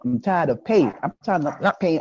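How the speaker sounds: ordinary talk, nothing marked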